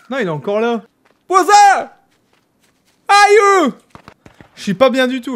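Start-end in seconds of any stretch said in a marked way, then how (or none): 1.3-3.7 high, loud yelps; crying out. Real, not acted. Gamer crying out mid-fight, high loud yelps. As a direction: yelp loudly in alarm. Cries out during game fight.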